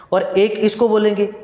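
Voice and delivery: ordinary delivery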